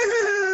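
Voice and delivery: high, loud mimicked laugh; imitated lady's laugh. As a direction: mimic a woman laughing loudly. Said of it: "I hear in the background".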